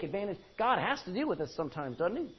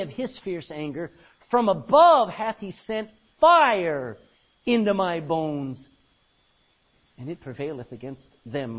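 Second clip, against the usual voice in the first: loud, declaiming King James reading; scripture reading; 3 semitones higher, 9 dB louder than usual. Acted. Preacher reads Lamentations to congregation, declaiming. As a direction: proclaim the verse to the hall.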